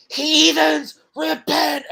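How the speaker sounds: mock fire-and-brimstone from a small boy; hammed-up child evangelist crying out; kid preacher shout. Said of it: "shaking to going".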